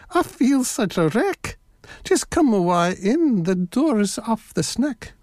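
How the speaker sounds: high put-on granny voice; wolf posing as a granny; fake old lady. A narrator's reading. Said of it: "his best old lady's voice".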